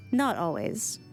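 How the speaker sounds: ordinary talk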